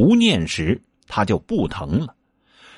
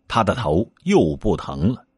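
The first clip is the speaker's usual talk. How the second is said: ordinary delivery, nothing marked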